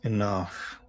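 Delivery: low, soft stern word; commanding lord. Acted. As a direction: cut them off, low and grave. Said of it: "you hear".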